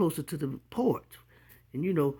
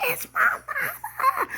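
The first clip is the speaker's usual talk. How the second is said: put-on much higher, slow little-girl squeal; 3 dB louder, slower than usual. Girl seeing her mama arrive, squealing with laughter. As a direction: squeal like a delighted child. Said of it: "I said".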